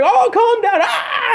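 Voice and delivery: hammed-up panicked staff yelling over the noise; frantic yell. Dentist's workers shouting at the screaming patient, high mock yell. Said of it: "And they're screaming".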